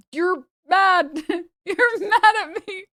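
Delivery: high, laughing mock of "you're mad". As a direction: laugh it out while mocking him. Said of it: "I'm like".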